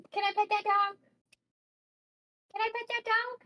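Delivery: high, eager begging voice; high pleading. Acted. Player begging the game to let them pet the dog, high and eager. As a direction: beg like a kid who wants a puppy.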